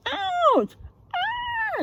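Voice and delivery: high squealing ouch and ah; stung yelps. Acted. The big blue thing crying out at the stings. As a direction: yelp as if stung. Teacher acting the stung beast.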